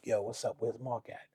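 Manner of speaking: low, hushed undertone; retold whisper. [name] quietly asks his friend where [name] is, retold whisper. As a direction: murmur it like a private question. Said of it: "he whispers to me".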